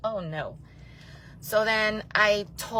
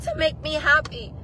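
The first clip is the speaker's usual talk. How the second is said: hype; 7 semitones higher than usual. Retold or imitated. Telling her friend she's fine on the floor, all crazy and hype. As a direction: pump it up like a hyped-up friend. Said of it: "all crazy and hype like this".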